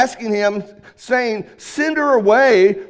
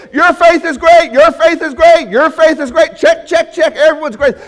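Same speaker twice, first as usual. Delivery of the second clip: higher, louder, rattled-off repeats; mock careless praise; 6 semitones higher, 6 dB louder than usual. Preacher mocking a Jesus handing out praise, higher and louder. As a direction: toss out praise like ticking boxes. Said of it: "He didn't go around saying".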